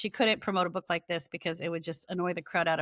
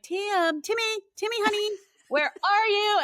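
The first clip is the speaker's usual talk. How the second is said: much higher, louder, slow teasing call; sing-song calling mom; 14 semitones higher, 5 dB louder, slower than usual. Retold or imitated. Mom calling her son through a megaphone at the water park, sing-song. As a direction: call across the park like a doting mother.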